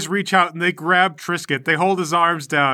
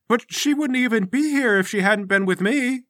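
Bingle's voice; 7 semitones higher than usual. Acted.

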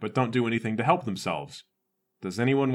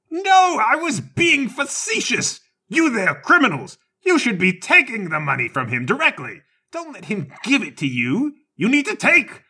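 high, loud indignant shouting; hammy old man ranting; exasperated rant; 9 semitones higher, 5 dB louder than usual. Acted. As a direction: scold them all at the top of his voice. Whip guy ranting at everyone.

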